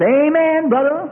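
high, loud mocking amen; a sly, gleeful devil's amen. Preacher voicing the devil cheering a quitter on, high and mocking.

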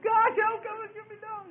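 hammed-up wail, very high; blubbering cry. David begging God for rescue, exaggerated blubbering. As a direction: whine out a desperate mock plea. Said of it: "start crying out to God and blubbering again".

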